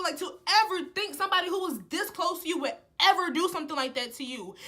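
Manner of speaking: loud, high accusing rant; confronting the friend who ratted her out; indignant confrontation. Retold or imitated. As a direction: tear into the snitch.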